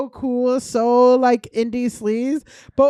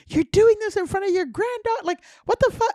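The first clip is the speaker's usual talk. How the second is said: indignant scolding; 8 semitones higher than usual. Retold or imitated. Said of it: "and I'm like".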